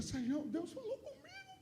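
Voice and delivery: high, put-on voice of the friend; imitating Bega. Bega retold, high strange voice. (imitando o amigo com voz aguda)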